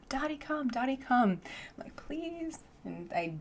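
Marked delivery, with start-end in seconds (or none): 0.1-1.4 higher, softer coaxing call; calling the dog softly. Retold or imitated. Calling Dottie quietly with the mic muted, coaxing. 1.9-2.6 higher, softer whispered please. Retold or imitated. Pleading with the dog in a whisper.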